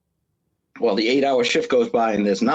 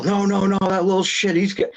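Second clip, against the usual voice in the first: paranoid coworker; 9 semitones higher than usual. Retold or imitated. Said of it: "He's like".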